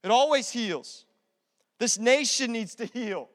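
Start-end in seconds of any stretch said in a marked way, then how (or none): none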